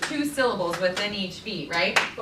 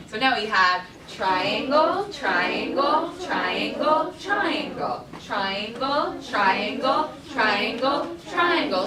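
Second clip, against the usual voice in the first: "triangle" chanted louder, one per beat; 2 semitones higher, 4 dB louder, slower than usual. Shown as a demonstration. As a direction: fit three syllables in each beat. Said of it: "Something with three syllables".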